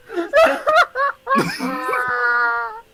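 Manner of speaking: high wheezing laughter; loud laughing fit. Real, not acted. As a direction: laugh until crying. Cracking up over the voice.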